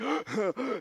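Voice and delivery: high, sharp gasp, like a woman breaking down; sharp gasping sob. Retold or imitated. A weeping woman's sharp gasp, high and loud. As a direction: gasp as the woman at his feet starts weeping. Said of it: "She's like".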